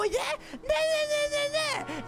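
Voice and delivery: high singsong nah-nahs; mocking singsong taunt. Imitating co-host's taunting in a high singsong. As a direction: taunt like a kid. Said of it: "And then you go".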